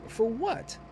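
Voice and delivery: high, disbelieving question; baffled pitch jump; incredulous. Retold or imitated. Passenger baffled by her threat.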